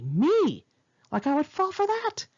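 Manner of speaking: high, mocking huff of outrage; indignant outrage. Real, not acted. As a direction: scoff in offended disbelief. Outraged at his advances.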